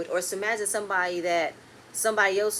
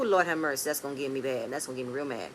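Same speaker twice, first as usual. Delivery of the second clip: usual speaking voice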